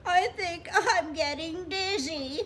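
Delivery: high, loud tearful wail. Acted. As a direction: bawl it out, dizzy from crying. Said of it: "Bird cried louder".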